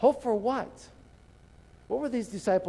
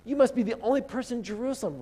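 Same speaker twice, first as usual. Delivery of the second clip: pitched up, disbelieving You must be the only person; incredulous scoff; 6 semitones higher, faster than usual. Retold or imitated. Disciples scoffing at stranger, higher incredulous voice. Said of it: "they're like".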